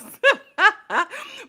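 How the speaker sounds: high burst of laughter; big high laugh; loud laugh. Real, not acted. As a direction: cackle out loud. Laughs at her reaction.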